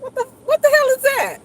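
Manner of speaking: high, loud mock disbelief; mock appalled reaction. Starer reacting to someone caught looking, loud mock shock. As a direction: squawk it like a shocked onlooker. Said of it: "they be like".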